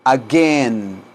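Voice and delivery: "Again" drawn out, low and falling, with hard g; pronunciation model. Shown as a demonstration. Teacher shows students how "Again" sounds, hard g, drawn out. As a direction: stretch the second syllable of "Again", hard g. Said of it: "La pronunciación de again".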